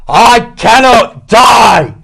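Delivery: very slow, loud, high screamed cry; desperate scream. Acted. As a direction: scream defiance at death. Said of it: "Hexwell screamed".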